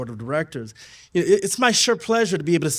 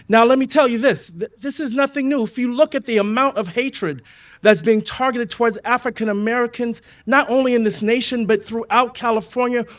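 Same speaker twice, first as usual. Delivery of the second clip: fiery rally speech, higher and louder; 3 semitones higher, 3 dB louder than usual. Real, not acted. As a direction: rouse the crowd, hammering each line. Speech building to full force.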